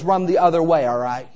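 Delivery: ordinary talk, nothing marked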